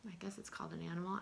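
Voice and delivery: quick unvoiced whisper, fast; hushed side remark; whispered aside. Real, not acted. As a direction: mutter it to yourself under the breath. Teacher's quick aside to herself.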